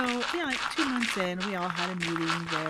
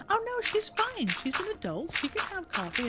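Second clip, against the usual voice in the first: mock teacher; 12 semitones higher than usual. Teacher voiced dismissing worry.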